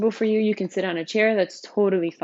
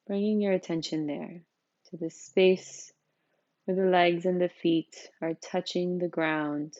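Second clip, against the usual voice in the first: softer, unhurried voice, settling listeners into the body; guided meditation; 4 dB quieter than usual. Real, not acted. Teacher leading newcomers through a seated practice, calm meditation guidance.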